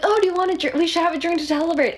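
high, fast, overly delighted parents greeting; sugary welcome from eager parents; gushing parents. Retold or imitated. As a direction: play the parents thrilled to meet him. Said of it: "And they're like".

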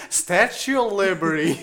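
loud, high country western twang through laughter; mock country western accent. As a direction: imitate a botched New York accent gone western. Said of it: "New York words in a country western accent".

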